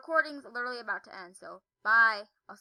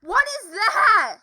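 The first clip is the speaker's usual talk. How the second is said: alarmed slow shout; 7 semitones higher, 16 dB louder, much slower than usual. Real, not acted. Spots creepy thing in game.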